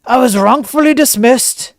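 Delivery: mock The Rock bragging; The Rock impression. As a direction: boom it out like a showman wrestler. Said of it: "saying things like".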